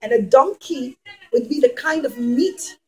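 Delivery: plain reading voice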